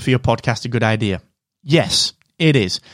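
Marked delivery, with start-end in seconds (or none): none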